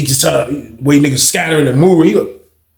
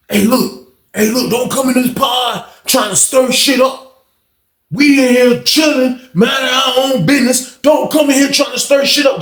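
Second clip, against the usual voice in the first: high, slow, drawn-out telling-off; retold stern warning; 9 semitones higher than usual. Inmate telling a troublemaker to keep out, heated warning. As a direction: stretch each phrase out high and forceful.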